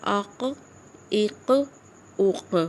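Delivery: "اق" with the q bouncing back lightly; short "اق" ending in a small q release; qalqalah echo. Shown as a demonstration. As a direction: let the q of "اق" echo without doubling it. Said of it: "اس کی آواز ہی پلٹ رہی ہے".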